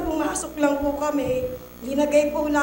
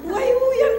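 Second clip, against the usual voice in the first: laughing protest; 9 semitones higher, 7 dB louder than usual. Retold or imitated. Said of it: "nag-away kami ng doktor".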